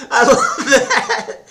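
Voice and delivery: squealing with laughter, slowed; delighted laugh through the words; high laughing gush. Real, not acted.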